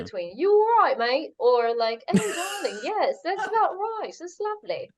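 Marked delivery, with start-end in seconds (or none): 0.4-1.3 cheery East London greeting, pitched up and louder; London geezer saying hello, English accent. Acted. S1 shows off her East London English accent to friends. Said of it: "like, East London". 2.1-4.9 put-on higher, plummy posh English accent; posh queen accent. S1 shows off her posh queen English accent on the podcast. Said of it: "like, posh queen or".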